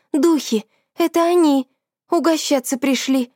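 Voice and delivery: frightened cry. Acted. Friend frightened by spirits photo.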